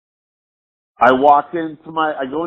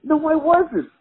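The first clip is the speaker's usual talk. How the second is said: mock denial; 12 semitones higher, slower than usual. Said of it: "And I'm like".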